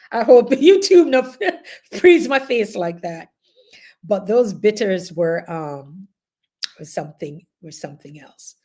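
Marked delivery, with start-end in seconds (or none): 0.1-3.3 higher, louder, through laughter; amused, breaking into a laugh. Real, not acted. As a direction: laugh in the middle of the sentence. Laughing at her own face.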